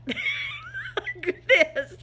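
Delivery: laughing through the words. Real, not acted. Laughing while describing the divots.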